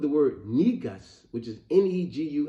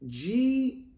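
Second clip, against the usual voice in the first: one high, tentative drawn-out letter; imitated reluctant spelling; 10 semitones higher than usual. Retelling the boy's next letter, reluctant. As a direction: offer the letter like a nervous contestant. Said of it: "he was reluctant at that point".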